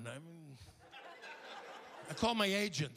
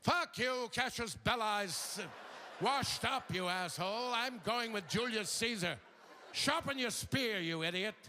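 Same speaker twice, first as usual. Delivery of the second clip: grand mock costume-drama actor, pitched up in bursts; cursing BBC Roman; 2 semitones higher than usual. Comic parodies the Rome series, grand cursing Roman voice. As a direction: curse like an elegant Roman on television. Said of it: "elegant series they did with the B B C".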